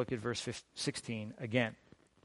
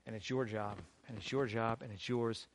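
soft, low, earnest appeal; solemn charge; 5 semitones lower, 7 dB quieter than usual. Real, not acted. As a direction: bring the voice down and lay it on each listener. Solemn personal charge to congregation.

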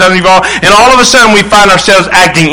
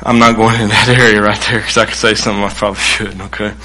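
quick, low, softer aside; 9 semitones lower, 6 dB quieter, faster than usual. Real, not acted. Preacher tells congregation he's not going there, hushed aside. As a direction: drop the voice and back away from the topic.

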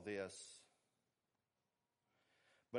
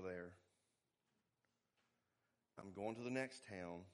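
gentle, kind; 4 semitones lower, 4 dB quieter than usual. Retold or imitated. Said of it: "What does he say?".

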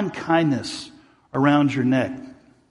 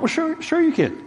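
pitched way up, sarcastic "sure, sure"; mock sure retort; 11 semitones higher than usual. Preacher mocking the clerk, high sarcastic retort. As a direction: toss it back high and cocky. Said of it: "I'm like".

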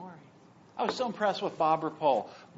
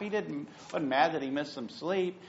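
ordinary delivery

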